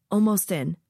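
usual speaking voice

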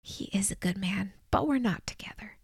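soft breathy whisper, no voice at all. A narrator's reading. Pero whispers to dying Jimmy about Sam, hushed whisper. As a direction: whisper it so the others can't hear. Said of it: "I shook my head and whispered".

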